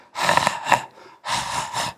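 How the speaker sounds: voiceless, airy rasp in short bursts; hoarse voice demo. Shown as a demonstration. Doctor showing how a child's strained voice comes out, hoarse. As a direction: force out husky breaths like a worn-out voice. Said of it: "이렇게 나오죠".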